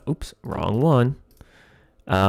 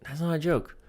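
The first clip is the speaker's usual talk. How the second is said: much higher and much softer, a meek little character voice; small high put-on voice; 6 semitones higher, 9 dB quieter than usual. Writer acting out the comic's Siri line in a soft high voice.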